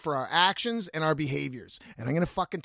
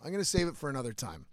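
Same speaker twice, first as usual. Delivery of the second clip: quick, low, softer aside; hushed, hurried decision; quiet aside; 4 semitones lower, 8 dB quieter, faster than usual. Real, not acted. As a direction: say it fast and under your breath, as if deciding to yourself. Decides aloud to postpone the rant.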